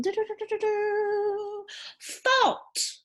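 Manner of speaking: high, sustained rolled "do-do-do" drumroll, then the reveal; vocal drumroll. Shown as a demonstration. Teacher revealing the next word, high playful drumroll. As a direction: build suspense with a high-pitched drumroll noise.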